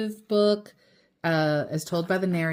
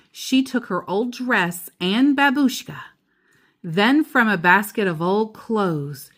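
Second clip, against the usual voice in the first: steady storybook read-aloud; reading to a child; 6 dB louder than usual. A narrator's reading. Parent reading The Keeping Quilt to child, storybook voice. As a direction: read it gently to your child at bedtime.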